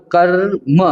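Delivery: कर्म said slowly, र sounded long before म; drawn-out pronunciation. Shown as a demonstration. Teacher showing how half र is read, कर्म drawn out. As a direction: stretch the र in कर्म, then land on म. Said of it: "इसका उच्चारण माँ से पहले होगा".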